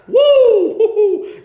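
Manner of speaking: high whooping laugh. Real, not acted. Preacher whooping with delight at his own point.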